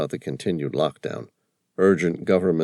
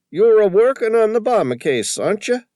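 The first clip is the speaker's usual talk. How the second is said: high, loud, excited Tony voice with a put-on accent; an indignant shopkeeper squawking about the photo; riled old Tony; 7 dB louder than usual. A narrator's reading. Shopkeeper upset at the vandal, loud high indignant voice. Tony guesses Sam's case.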